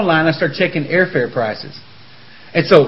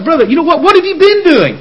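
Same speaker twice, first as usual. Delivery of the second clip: exclamation; 11 semitones higher, 5 dB louder than usual. Real, not acted. Preacher calling out to listeners.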